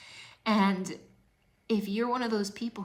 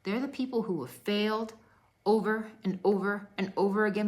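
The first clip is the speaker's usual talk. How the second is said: ordinary talk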